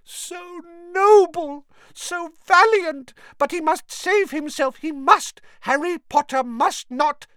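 high, slow, loud elf moan; ecstatic house elf. Acted. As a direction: swoon in miserable ecstasy. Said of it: "miserable ecstasy".

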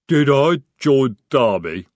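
loud rumbling troll voice. Acted. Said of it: "he rumbled".

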